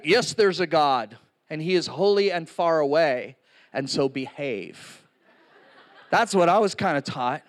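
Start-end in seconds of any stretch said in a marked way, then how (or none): 0.1-5.0 lower, mock-solemn preachy voice; stern religious upbringing. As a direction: imitate a stern old-school teaching, lower. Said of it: "It's like".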